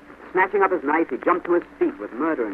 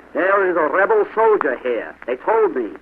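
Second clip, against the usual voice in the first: loud, high-pitched accusation; sergeant's yell; 5 semitones higher, 6 dB louder than usual. A narrator's reading. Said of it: "yelled the sergeant".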